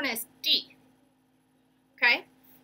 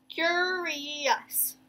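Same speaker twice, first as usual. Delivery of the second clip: "curious" higher and drawn out; three syllables; 5 semitones higher than usual. Shown as a demonstration. Teacher recapping the parts, "curious" slowly by syllable. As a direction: lift and stretch "curious" over three beats. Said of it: "Three syllables".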